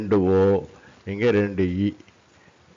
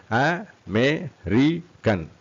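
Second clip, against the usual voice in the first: very slow A - ME - RI - CAN; four separate beats on American; syllable by syllable; 3 semitones higher, much slower than usual. Shown as a demonstration. Teacher demonstrates four syllables, syllable by syllable.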